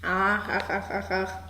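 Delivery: low, soft croaking frog. A narrator's reading. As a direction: croak each ach like a frog by the pond. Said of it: "dann fängt sie wieder an zu ropen". (leises Froschquaken)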